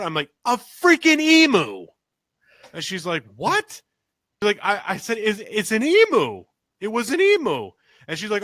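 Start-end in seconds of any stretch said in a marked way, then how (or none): 0.4-1.9 high, loud, drawn-out exclamation; amazed shout. Retold or imitated. Retold cry announcing the emu. 3.4-3.8 very high, incredulous question; startled wife. Retold or imitated. Wife's retold startled reply. 5.2-7.7 high, loud, excited insistence; insistent shout. Retold or imitated. Retold insisting it was an emu.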